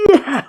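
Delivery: high-pitched mock yell; cartoon yelp. Cartoon cry as head gets bashed.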